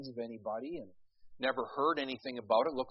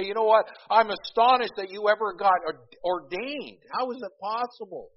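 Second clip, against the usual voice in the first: higher and louder, hammed-up disbelief; mock incredulous heckler; 7 semitones higher, 7 dB louder than usual. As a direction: play a cheeky churchgoer teasing the preacher. Imagined listener mocking the preacher.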